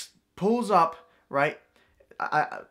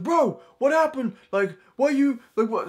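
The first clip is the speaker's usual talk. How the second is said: put-on confrontational drunk; 9 semitones higher than usual. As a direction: imitate an indignant drunk, pitched way up. Drunk man confronts the dad.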